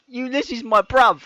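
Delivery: high, loud mock London chav; London chav accent. Host greets Ulysses in loud mock London chav. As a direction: greet him like a London hooligan, for laughs. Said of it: "I'll be like".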